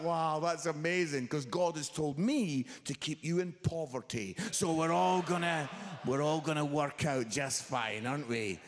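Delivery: forceful, mock-delighted comeback; sarcastic dad. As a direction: hit back loudly with sarcastic agreement. Dad sarcastically hits back at son.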